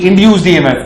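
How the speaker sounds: ordinary talk, nothing marked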